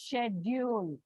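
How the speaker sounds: schedule as "SHED-yool"; British schedule. Shown as a demonstration. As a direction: open schedule with a soft SH. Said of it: "This schedule is British way".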